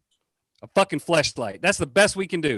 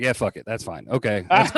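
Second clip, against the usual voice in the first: mock resigned guys; 8 semitones lower than usual. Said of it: "And we're like".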